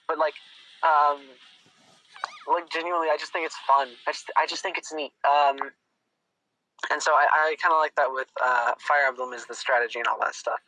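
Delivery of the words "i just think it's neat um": normal talking voice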